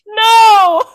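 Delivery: high shrieking no of embarrassment; embarrassed wail. Real, not acted. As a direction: wail it, mortified. Embarrassed by her own writing.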